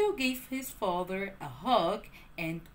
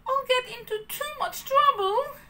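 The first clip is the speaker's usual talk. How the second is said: put-on worried puppet boy; 13 semitones higher, 4 dB louder than usual. A narrator's reading. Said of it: "cried Pinocchio".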